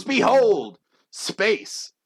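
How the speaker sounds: loud, high showman's proclamation; grand unveiling. Acted. Sawyer revealing people pulled from nowhere, grandiose. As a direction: present it like a great wonder. Contestant unveils his power.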